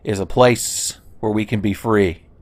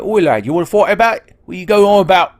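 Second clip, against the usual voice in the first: higher, louder mock Cockney bloke picking a fight; 10 semitones higher, 6 dB louder than usual. Streamer voices a game character as a Cockney tough, mock Cockney. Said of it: "stereotypical, like, Cockney British".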